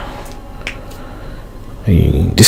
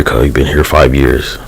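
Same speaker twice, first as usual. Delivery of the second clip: ordinary delivery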